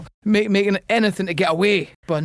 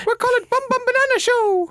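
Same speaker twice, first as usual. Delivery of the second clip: much higher falsetto, laughing through it; squeaky high voice. Pretend. Mocking the banana show host, squeaky falsetto. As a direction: go way up into a silly falsetto. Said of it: "his voice goes up like that".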